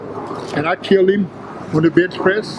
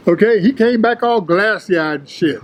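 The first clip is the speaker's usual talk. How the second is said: ordinary talk, nothing marked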